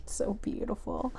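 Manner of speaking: soft, breathy-free hush, almost to herself; hushed awe. Real, not acted. Reader moved, murmuring in soft awe to viewer. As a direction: murmur it in quiet wonder.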